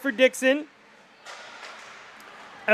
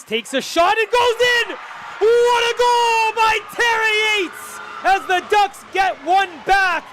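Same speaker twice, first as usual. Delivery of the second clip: high, stretched goal shout; long goal yell; 9 semitones higher than usual. Real, not acted. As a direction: call the goal at full stretch. Goal call for Ducks.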